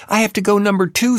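put-on high, quick Boston accent holler; Boston accent yell. A narrator's reading. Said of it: "some woman with a Boston accent yells".

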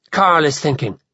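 ordinary delivery, nothing marked

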